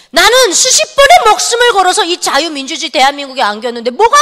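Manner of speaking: loud, high, resentful complaining outburst; bitter rant at God. Retold or imitated. 하나님께 억울함을 쏟아내며 소리 높여 따지는 투정. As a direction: vent the grievance like a wronged child, raising the voice. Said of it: "해악질이 시작이 됐어요".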